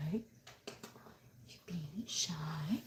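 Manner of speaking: very slow, hushed whisper to a pet; barely audible, teasing coo; soft cooing. Real, not acted. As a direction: whisper it tenderly, drawn right out. Cooing softly at shy cat.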